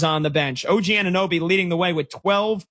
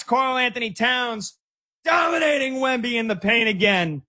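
higher-pitched, hyped-up game recap; excited sports call; 5 semitones higher than usual. Real, not acted. Radio host hyping the Knicks' run to listeners, excited sports call. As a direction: hype the home team's run, pitch up.

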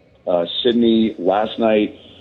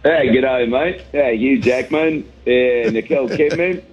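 hammed-up Aussie twang, pitched up; mock Australian accent; 3 semitones higher, slower than usual. Comedian greeting podcast hosts, hammed-up Australian accent. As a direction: play the jokey Aussie bloke saying g'day. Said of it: "picked up a little bit of an accent".

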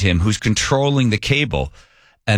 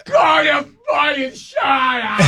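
much higher, slow shouted rage; furious outburst; 14 semitones higher, slower than usual. Retold or imitated. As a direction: yell it through a crying break. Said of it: "I was so mad at them".

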